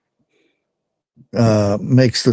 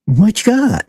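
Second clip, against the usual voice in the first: high squeaky cat voice; 11 semitones higher than usual. Pretend. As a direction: voice the cat begging for food. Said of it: "the cats would attack me".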